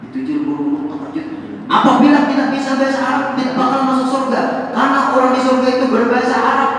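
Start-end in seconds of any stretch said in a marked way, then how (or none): 1.7-6.7 loud, high fervent preaching. Acted. Preacher warning the congregation, loud and fervent. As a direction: hammer the point home to the hall.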